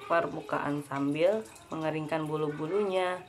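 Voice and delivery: ordinary delivery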